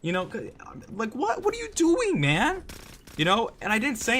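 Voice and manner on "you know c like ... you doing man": very high, cracking upward in shock; stunned disbelief. Retold or imitated. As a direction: let the voice shoot up, baffled. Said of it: "I was just like".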